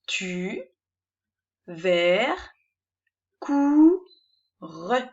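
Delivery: "Tu, vers, cou, re" said as separate beats with pauses; "Tu, vers, cou, re" cut into syllables. Shown as a demonstration. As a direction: model each syllable of "Tu, vers, cou, re" on its own. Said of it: "vous allez me lire ces syllabes".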